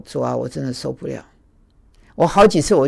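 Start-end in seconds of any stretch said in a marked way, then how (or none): 0.0-1.2 weary, hushed plea to the Lord; quiet complaint. Retold or imitated. Complaining to God about the roommate, hushed and weary. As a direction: sigh it out to God, spent. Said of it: "我说".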